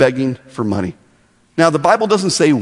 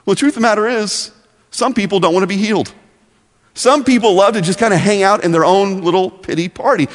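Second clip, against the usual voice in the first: ordinary talk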